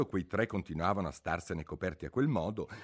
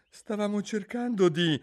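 high-pitched, grovelling explanation; extremely humble; 13 semitones higher, 5 dB louder than usual. A narrator's reading. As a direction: explain humbly to an angry queen. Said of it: "con tono che più umile non si può". (tono umilissimo)

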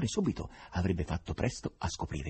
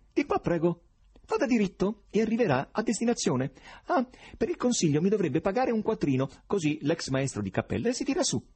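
high, cracked tenor, ingratiating; 13 semitones higher than usual. Acted. Checkered fellow accosting Berlioz at the exit, cracked tenor. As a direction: wheedle like a shabby clown. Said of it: "con fessa voce tenorile".

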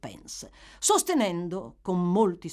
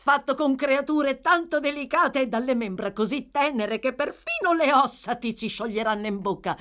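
put-on higher, brisk boastful boy; eager young Charlie; 4 semitones higher than usual. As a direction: show off the treats. (il giovane Charlie che vanta il cibo, acuto e vivace)

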